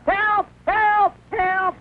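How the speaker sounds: high frantic calls for help; yelling for help in panic; panicked cry. Acted. Man bitten by a bug yells for help in panic.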